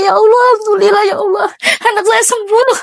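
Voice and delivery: real happy tears spilling into the words; crying with relief. Real, not acted. Mother hears her son recovered, crying with relief. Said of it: "Saya nangis".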